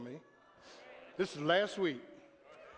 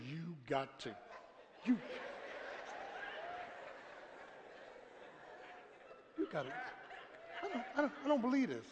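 muttering under breath; 3 semitones higher, 10 dB quieter, faster than usual. Retold or imitated. Pastor stuck in line, muttering to himself in exasperation.

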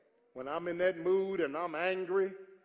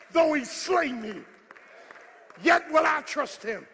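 full-force preaching; 9 semitones higher, 10 dB louder than usual. Acted. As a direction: lift the church with every phrase.

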